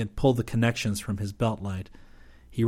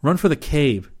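raised, loud command; 5 semitones higher, 5 dB louder than usual. A narrator's reading. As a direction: order the men to move. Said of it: "Rip commanded".

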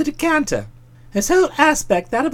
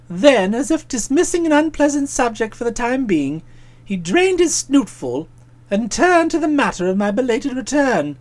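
usual speaking voice

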